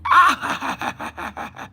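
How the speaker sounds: high-pitched mock gasp into laughter; mock awed laugh. Imitating Americans hearing the accent, high laughing squeal. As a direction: squeal and cackle like a delighted fan. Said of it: "people over here go like".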